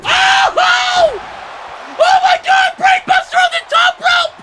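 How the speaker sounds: high, loud shrieking call; frantic play-by-play yelp; excited shriek. Real, not acted. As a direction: scream it like the roof just fell in. Commentator erupts at top-rope move.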